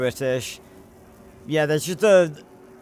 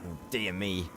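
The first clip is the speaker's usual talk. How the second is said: put-on much lower and much softer posh old-gent sigh of dismay; a fussing Archibald, low and hushed; 8 semitones lower, 10 dB quieter than usual. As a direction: mutter it like a fussy English aristocrat. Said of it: "I did say this when I was playing it".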